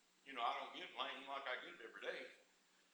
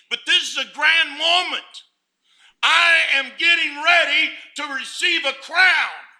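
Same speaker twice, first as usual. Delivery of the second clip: preaching at full force; 9 semitones higher, 21 dB louder than usual. Acted. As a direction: thunder it out to the congregation, slowing on the key words.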